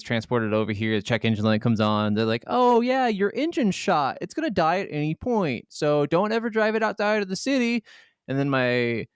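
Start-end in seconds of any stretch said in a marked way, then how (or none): 2.5-7.8 higher-pitched voice for the mechanic; retold mechanic. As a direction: quote the mechanic's warning in a raised voice. Retells a mechanic's warning.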